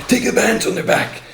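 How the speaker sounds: high, very loud police command; barked order. Retold or imitated. Officer ordering him to put hands behind, very loud high bark.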